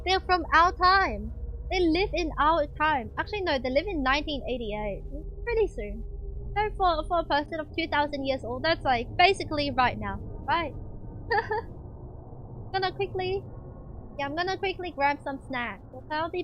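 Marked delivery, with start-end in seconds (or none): 0.0-3.1 slow emphatic reveal. Real, not acted. Dramatic reveal about royals. 10.8-12.7 soft airy breathy laugh; breathy silent laugh. Real, not acted. Laughs at own joke.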